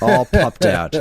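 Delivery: loud laughing words. Real, not acted. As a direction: laugh through the phrase. Laughing about exhausted dog.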